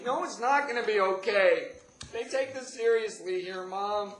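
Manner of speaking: loud, very high frantic protest; hammed-up panicked outburst, high and loud; faked panic. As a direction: shout back in put-on desperation. Acted panic to mother.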